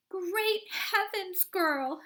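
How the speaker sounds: high, slow screech of horror. A narrator's reading. Mother screeching at her swelling daughter. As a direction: shriek it at the girl. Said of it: "screeched Missus Beauregard".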